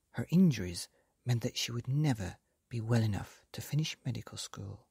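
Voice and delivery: ordinary delivery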